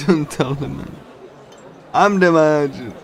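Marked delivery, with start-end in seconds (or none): none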